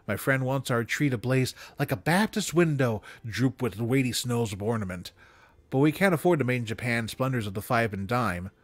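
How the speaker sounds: usual speaking voice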